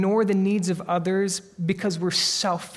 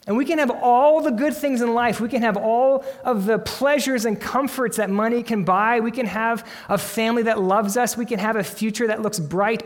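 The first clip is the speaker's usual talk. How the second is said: loud, high, driving sermon build; preaching at full force; 5 semitones higher, 5 dB louder than usual. Acted. Preacher rousing his congregation to the stakes, loud and high. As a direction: push each line out to the back pews.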